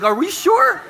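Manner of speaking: mock disbelief. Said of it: "mock Ravens fan disbelief".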